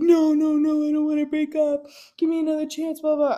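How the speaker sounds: high, whiny begging boyfriend impression; mock pleading. Girlfriend mimics her boyfriend begging her to stay, mock pleading.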